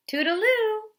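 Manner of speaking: high, loud cheery farewell; high, loud call. Acted. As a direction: sing out a goodbye from the doorway. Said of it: "called the mouse".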